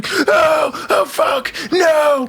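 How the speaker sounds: high panicked shrieks of no; terrified screaming. Retold or imitated. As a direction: squeal in horror at a wriggling snake. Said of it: "I am screaming the entire time".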